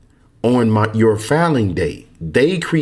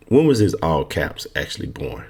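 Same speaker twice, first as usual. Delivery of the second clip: ordinary talk, nothing marked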